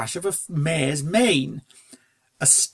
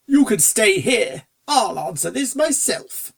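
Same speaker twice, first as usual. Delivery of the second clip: slow, higher, firm but kindly order; a lightly voiced aristocrat dismissing his butler; genteel gentleman; 6 semitones higher, 4 dB louder than usual. Fancy Pants instructs butler.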